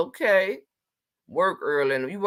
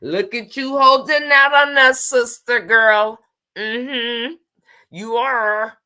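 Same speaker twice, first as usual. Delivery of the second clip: playful teasing; 7 semitones higher, 9 dB louder, slower than usual. Real, not acted.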